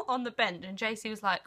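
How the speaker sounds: ordinary delivery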